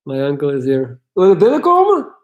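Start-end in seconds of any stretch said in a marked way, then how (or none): 1.1-2.1 loud, high call across the house. Real, not acted. Streamer calls his uncle in from the door, loudly. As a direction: call out to someone arriving.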